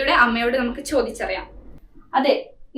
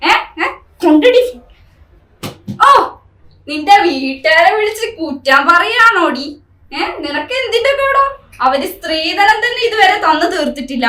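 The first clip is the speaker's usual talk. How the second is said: loud, harsh scolding; angry shout; 2 semitones higher, 4 dB louder than usual. Acted. Husband shouting at wife for calling her family. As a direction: berate her at full voice.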